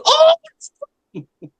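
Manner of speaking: imitating his own high-pitched, excited yell. Telling of finding Splinter with his wife, retold excited yell. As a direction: squeal it out like a thrilled fan. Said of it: "and I yelled".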